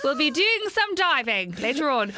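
ordinary talk

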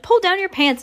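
high-pitched urgent call; shouted order. A narrator's reading. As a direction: call out high, urging him on. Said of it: "someone else shouted".

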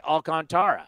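Alcantara said al-con-TAR-uh; announcers' pronunciation. Shown as a demonstration. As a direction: Alcantara with a full TAR before the final ra. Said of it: "you listen to their play-by-play guys".

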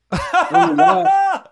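laughing, then squeaky high and slow on the last words; laughing disgust. Retold or imitated. Laughing retelling of taste test.

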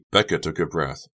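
usual speaking voice

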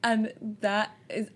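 ordinary talk

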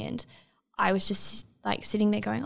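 normal talking voice

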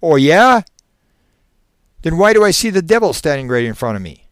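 high, very loud, indignant outburst; shrill retort. Acted. Frightened man cuts in on the hypnotist, shrill. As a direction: cut in shrilly, then race through the challenge. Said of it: "with a shrill tone in his voice".

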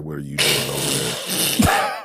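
low, slow stern voice at the door; deep slow demand. Pretend. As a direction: drag it out low and heavy. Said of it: "I'ma knock on the door and be like".

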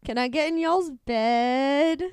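loud, slow, sultry plea to a god; flirty come-on. Pretend. Asking Hades to join his bed, slow loud flirt. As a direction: drawl the offer out, half-joking. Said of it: "tell Hades".

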